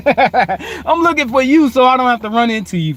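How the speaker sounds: high, amused punchline after a laugh. Real, not acted. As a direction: repeat the joke through your own laughter.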